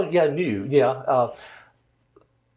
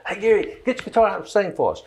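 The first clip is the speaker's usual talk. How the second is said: company calling out; 9 semitones higher, 3 dB louder, faster than usual. Retold or imitated. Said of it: "We'd have company say".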